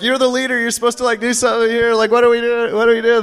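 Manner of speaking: hammed-up frantic plea to the leader; mock panic. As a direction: imitate a team member freaking out. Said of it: "this person's, like, totally freaking out on him".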